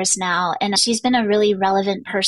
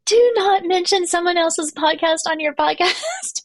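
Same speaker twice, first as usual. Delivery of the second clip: a higher, lightly mocked strict coach; mock stern coach; 8 semitones higher than usual. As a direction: wag a finger like a bossy coach, half laughing. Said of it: "my business coach would be like".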